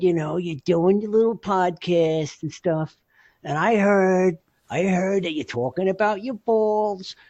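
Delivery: high, slow impression of an old-school buddy; Freddie. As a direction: explain it earnestly in your pal's put-on voice. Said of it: "this is how Freddie talks".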